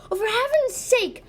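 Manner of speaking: high, loud cry of frustration; exasperated. Acted. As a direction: burst out in exasperation. Princess exasperated at her failed try.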